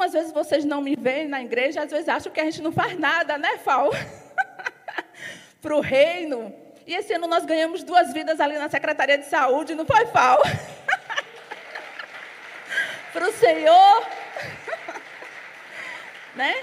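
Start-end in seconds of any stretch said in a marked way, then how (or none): 4.4-5.3 soft, high, airy giggle; breathy giggle. Real, not acted. Teasing friend Fal. 10.5-13.1 soft, high, airy giggle; breathy giggle. Real, not acted. Teasing friend Fal. 14.1-15.5 soft, high, airy giggle; breathy giggle. Real, not acted. Teasing friend Fal.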